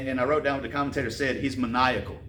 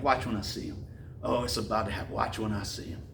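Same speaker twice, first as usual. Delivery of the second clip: low menacing mutter; 4 semitones lower, 6 dB quieter than usual. Retold or imitated. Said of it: "and you're like".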